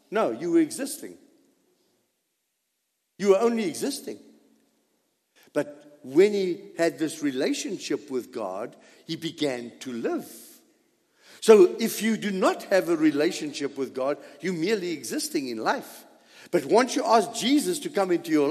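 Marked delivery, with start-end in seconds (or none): none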